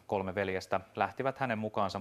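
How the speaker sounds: usual speaking voice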